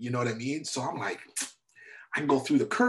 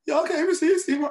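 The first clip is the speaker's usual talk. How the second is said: high, loud nervous quaver; quivering voice; 8 dB louder than usual. Retold or imitated. Nervous friend introducing Steve Harvey, high quivering voice. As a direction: ask it like a jittery fan meeting his idol. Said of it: "His voice was all quivering".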